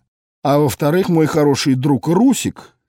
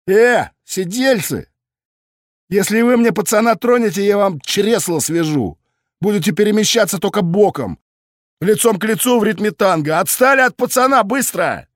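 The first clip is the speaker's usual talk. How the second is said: yelled threat; 7 semitones higher than usual. A narrator's reading. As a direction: yell across the street to scare them off. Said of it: "громко проорал пьяным придуркам".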